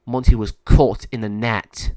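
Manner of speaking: ordinary delivery, nothing marked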